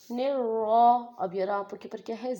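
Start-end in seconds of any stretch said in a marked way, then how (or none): 0.1-1.0 نرا with a heavy ر, said loud. Shown as a demonstration. Teacher showing heavy ر to learners. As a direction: hit the ر in نرا full and heavy. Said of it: "را کو موٹا ادا کرنا ہوتا ہے".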